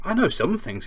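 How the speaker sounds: a hint of an affronted schoolboy; defensive boy. Harry defending himself to Hagrid, mildly defensive. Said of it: "he said".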